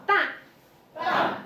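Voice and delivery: "Ba" high and cut short; short falling tone. Shown as a demonstration. Teacher models fourth tone, short and falling. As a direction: drop "Ba" quickly from the top. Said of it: "Make it in short".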